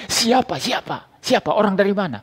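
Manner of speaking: a caught thief's alarm; father startled while stealing melons, high and panicky. Retold or imitated. Startled father asks who saw.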